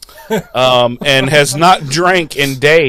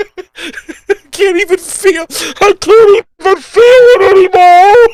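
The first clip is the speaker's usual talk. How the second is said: agonised wail; 7 dB louder than usual. Acted. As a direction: wail in hammed-up agony, getting louder. Acting the agonised demon in the wall.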